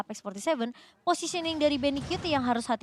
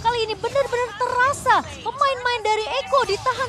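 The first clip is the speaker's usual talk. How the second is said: rising hype play-by-play, higher and louder; fighting game announcer; 9 semitones higher, 7 dB louder than usual. Acted. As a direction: call the big fight moment at full volume.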